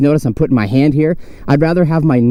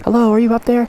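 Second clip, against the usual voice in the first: calling out; 11 semitones higher than usual. Real, not acted. Calling up to the rocks.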